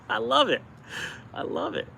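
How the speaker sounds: high, gushing delight, the second one breathy; excited squeal. Real, not acted. Vlogger gushing to viewers about empty stores, squealing. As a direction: squeal it with joy.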